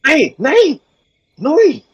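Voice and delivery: high-pitched yelping creature cry; high wolf yelp. Acted. GM voices the wolf's cry.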